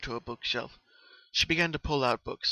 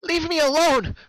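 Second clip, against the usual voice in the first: shrieking, much higher and much louder cry; terrified scream; 11 dB louder than usual. A narrator's reading. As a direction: scream it at a nightmare attacker. Scream from the nightmare.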